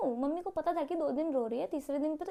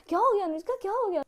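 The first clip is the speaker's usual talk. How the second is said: higher, louder mock-caring boy voice; concerned boyfriend; 7 semitones higher, 4 dB louder, faster than usual. Crush on the phone, fussing over a crying girl, mock-caring. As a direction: play up his sweet concern, a little high.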